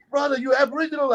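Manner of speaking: high-pitched, acted amazed question; excited disbelief. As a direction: imitate a delighted stranger, voice way up. Acts out excited stranger after hug.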